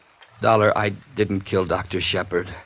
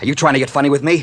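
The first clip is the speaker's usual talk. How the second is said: angry snap; 7 semitones higher, 7 dB louder, faster than usual. Acted. Gunman suspects mockery.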